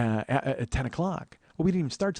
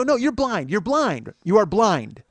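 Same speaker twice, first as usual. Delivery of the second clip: higher, insistent, hammering the word blind; blunt repeated retort; 6 semitones higher, 5 dB louder, much slower than usual. Retold or imitated. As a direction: keep cutting the heckler off. Shouting down blind heckler.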